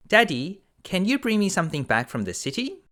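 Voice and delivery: put-on high, quick pleading girl voice; eager daughter. A narrator's reading. Oldest daughter asking father for a gift, high and eager. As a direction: ask Daddy for a present, bright and high. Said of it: "said the oldest daughter".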